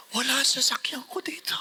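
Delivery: hushed whisper to himself; startled whisper. Retold or imitated. A priest realizing his car is missing, whispering.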